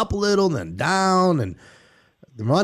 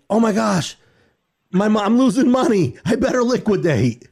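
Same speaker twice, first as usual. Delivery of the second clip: panicky, higher and rushed, half-hammed; mock panic; 6 semitones higher than usual. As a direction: play your own past panic while losing money. Mocks his own emotional panic selling.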